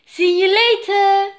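high, loud sing-out goodbye; little sister Louise. A narrator's reading. Louise to her departing brother, high little-sister voice. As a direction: sing out the farewell in a high child's voice. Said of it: "said Louise".

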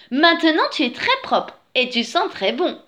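high, lightly delighted praise; pleased she-bear. A narrator's reading. She-bear praises the freshly washed bear, pleased.

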